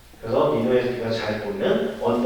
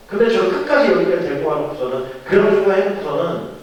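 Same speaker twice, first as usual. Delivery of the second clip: raised, higher-pitched indignant protest; worked-up, aggrieved outburst acted in a retelling; angry complaint; 5 semitones higher, 5 dB louder than usual. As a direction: complain loudly to God as a fed-up Jonah. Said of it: "요나가 막 승질을 내는 거야".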